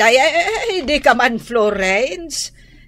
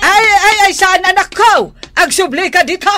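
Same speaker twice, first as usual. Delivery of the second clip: loud, high alarmed calling back; 9 semitones higher, 6 dB louder, faster than usual. Acted. Grandmother calling daughter back.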